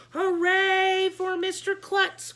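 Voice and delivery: high, loud, slow cheer; cheering shout. A narrator's reading. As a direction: cheer it like a crowd. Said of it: "we all shouted".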